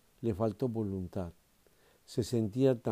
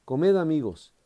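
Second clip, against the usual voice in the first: loud invitation; 6 semitones higher, 8 dB louder than usual. Acted. Señor invita en el versículo.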